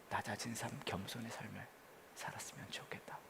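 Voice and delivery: preacher voicing God whispering to the faithful; God's whisper. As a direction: breathe it like God's hushed plea. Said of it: "그때 하나님은 그렇게 말씀하실 것 같아요".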